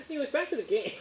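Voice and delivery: very high, sing-out dismissal; exaggerated topic change. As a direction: chirp it high to cut someone off. Acts out cutting Sam off.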